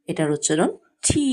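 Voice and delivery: ordinary talk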